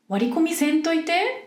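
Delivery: a playful, wobbly try at Kansai intonation; fake Kansai accent. Showing listeners how Kansai people might say it, in a fake Kansai accent. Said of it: "私のエセ関西弁のイントネーション".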